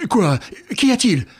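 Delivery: high, loud startled questions; alarmed exclamation. A narrator's reading. Baron rushing out at the commotion, high startled cry. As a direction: burst in asking what happened. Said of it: "s'écria le baron".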